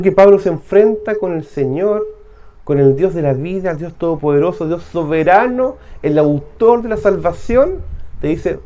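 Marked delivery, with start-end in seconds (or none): none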